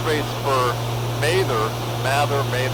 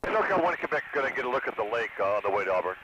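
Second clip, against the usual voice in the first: pilot radio call, quieter; over the radio; 2 semitones lower, 8 dB quieter, faster than usual. Real, not acted. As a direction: speak into the aircraft radio.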